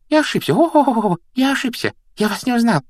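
high-pitched, frantic fawning; servile, flustered poltergeist; obsequious grovelling. A narrator's reading. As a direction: wriggle out of it, squeaking apologies. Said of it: "подобострастно заюлил он".

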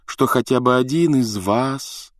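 high, slow, drawn-out singsong. A narrator's reading. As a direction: draw the vowels out like a mystic teacher chanting. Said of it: "говорила она нараспев". (нараспев, тягуче)

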